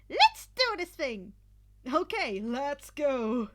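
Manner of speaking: high, loud rallying shout; battle cry. A narrator's reading. Hatsume rallying the team with a shout. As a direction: fire the team up.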